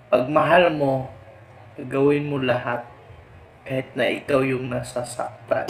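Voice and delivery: slowed, drawn-out heartfelt line; slow dramatic delivery. Shown as a demonstration. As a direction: say it slowly, like a love song. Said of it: "first take said normally".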